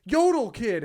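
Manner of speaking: high, loud call-out; retold starstruck shout; excited roommate. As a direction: holler it like a fan spotting someone. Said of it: "he runs outside, and he's like".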